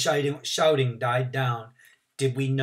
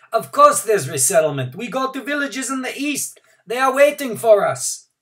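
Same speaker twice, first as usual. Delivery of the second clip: called out; 11 semitones higher, 9 dB louder than usual. A narrator's reading. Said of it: "Someone called out".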